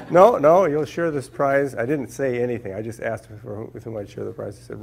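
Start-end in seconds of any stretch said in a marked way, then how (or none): 0.1-1.7 much louder, higher, laughing no-no, then slowing; amused committee caller correcting him, laughing and louder; laughing reply. Retold or imitated. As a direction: cheerfully brush off the question with a laugh. Committee's laughing reply to his question.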